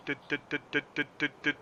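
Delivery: short plucked bass syllables; vocalized bass line. Shown as a demonstration. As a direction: tap out the bass line with your mouth. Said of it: "kinda that dut".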